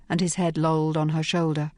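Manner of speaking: plain reading voice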